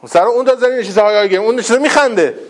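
ordinary talk

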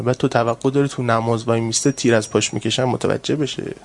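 normal talking voice

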